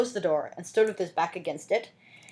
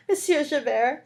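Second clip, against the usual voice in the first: higher pleading voice; 7 semitones higher than usual. Acted. Fantine begging Javert, high pleading. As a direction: beg him. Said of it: "I beg your pity".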